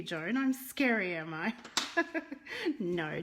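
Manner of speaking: normal talking voice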